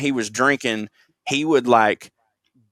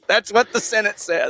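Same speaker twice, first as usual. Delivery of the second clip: much higher, put-on silly voice; mock high voice; 9 semitones higher than usual. Repeating the claim to co-host, mock high voice.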